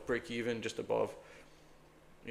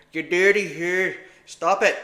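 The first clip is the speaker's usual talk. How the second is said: ordinary talk, nothing marked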